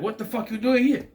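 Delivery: loud, high-pitched angry demand, imitated; angry outburst. Film character confronts an intruder in his room, mock-angry shout. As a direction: act out an angry man challenging a stranger. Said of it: "very angry".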